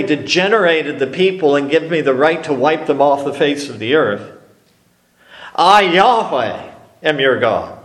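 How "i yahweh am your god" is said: "I, Yahweh" said slowly, with weight on "I"; slow emphatic I. Shown as a demonstration. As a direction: stake the name slowly and firmly. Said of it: "He's emphasizing who he is".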